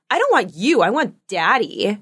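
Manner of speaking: imitated childish refusal; bratty little boy. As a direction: reject the parent like a stubborn kid. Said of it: "the way he said it".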